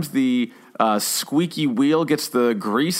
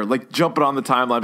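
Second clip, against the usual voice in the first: ordinary talk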